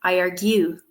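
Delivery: I ar-GUE, last syllable stressed; wrong-syllable stress. Shown as a demonstration. Teacher showing students' mistake, argue stressed on its second syllable. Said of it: "wrong syllable".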